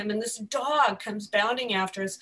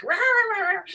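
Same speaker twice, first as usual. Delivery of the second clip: imitated barking; 11 semitones higher, 4 dB louder than usual. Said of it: "my dog's like".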